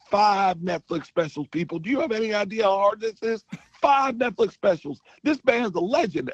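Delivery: big booming announcer praise; Steve Harvey impression. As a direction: hype the crowd like a TV host. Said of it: "And he goes".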